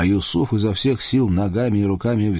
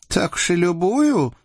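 high-pitched teasing question; playful doubt in a high voice; teasing girl; 11 semitones higher than usual. A narrator's reading. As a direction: needle him over his boast. Ksenia teases him, nudging him.

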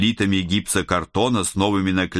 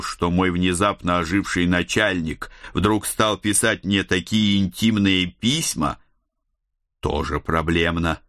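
ordinary delivery

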